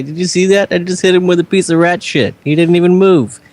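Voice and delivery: high, incredulous in-character amusement; amused jeering. Acted. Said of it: "I'm gonna laugh and say".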